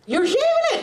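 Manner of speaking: high, astonished exclamation; shocked disbelief. Acted. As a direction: pitch shoots up in disbelief. Shocked at audience member's answer.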